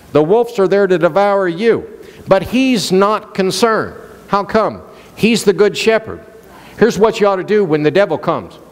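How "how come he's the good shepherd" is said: usual speaking voice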